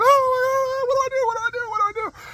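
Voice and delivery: very high-pitched panicked wail; hammed-up frantic squeal, repeating in panic; mock freak-out. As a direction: wail helplessly like a panicking child. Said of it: "I'm all freaking out, going".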